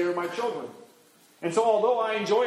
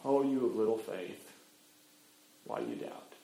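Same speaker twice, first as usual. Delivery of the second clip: very soft, low reproach; gentle rebuke; 8 semitones lower, 8 dB quieter than usual. Acted. Jesus to doubting Peter in the boat, quiet rebuke. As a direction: drop low and hushed, trailing away. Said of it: "what does Jesus say?".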